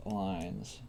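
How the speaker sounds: ordinary talk, nothing marked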